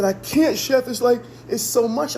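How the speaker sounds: high-pitched retold distress; imitated overwhelmed plea. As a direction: pitch it up as if it is all too much. Voicing Trey overwhelmed on the phone.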